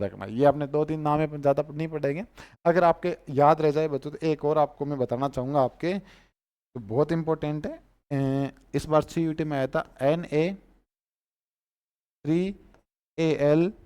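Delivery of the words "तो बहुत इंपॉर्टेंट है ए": usual speaking voice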